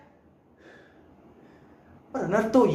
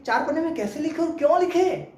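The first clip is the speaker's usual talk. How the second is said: raised pitch, indignant questioning; acted exasperation, pitched up; exasperated protest; 6 semitones higher than usual. Scolding elder protests an essay rule.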